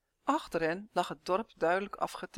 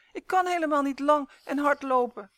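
loud, high complaint; wailing; 8 semitones higher, 9 dB louder than usual. A narrator's reading. Elf on short legs unable to keep up, wailing. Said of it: "jammerde Uf".

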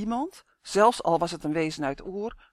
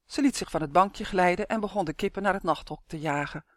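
ordinary delivery, nothing marked

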